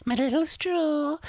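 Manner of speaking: very high, slow mock lilt; exaggerated cadence. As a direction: stretch it out in a high singsong. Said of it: "She goes up and down".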